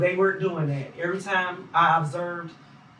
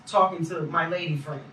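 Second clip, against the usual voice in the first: normal talking voice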